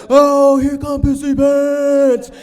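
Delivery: high, long-drawn mocking holler; shouted family jeer. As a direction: yell it out like a whole crowd jeering. Whole family jeers at reunion.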